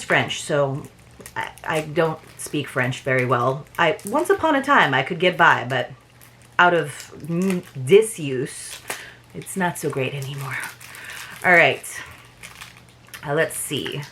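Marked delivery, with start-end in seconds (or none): none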